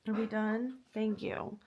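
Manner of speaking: quiet sarcastic relief; sarcastic quiet thanks. Real, not acted. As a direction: mutter it wryly once they stop. Dogs finally quiet.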